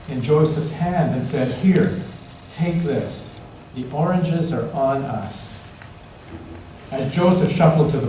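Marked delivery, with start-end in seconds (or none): none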